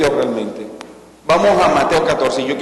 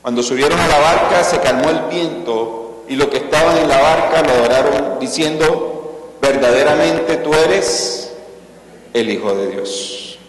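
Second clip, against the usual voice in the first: dramatic scripture reading; 3 semitones higher than usual. Acted. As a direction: read the story at full preaching force.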